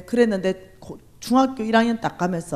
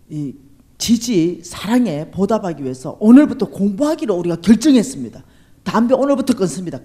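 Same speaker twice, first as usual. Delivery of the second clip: put-on loud, earnest declaration like boys pledging, with a laugh; boys' solemn pledge; 8 dB louder than usual. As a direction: proclaim the vow at full voice to their adopted mother. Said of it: "어머니를". (아이들이 엄마에게 맹세하듯 크게 외치는 선언)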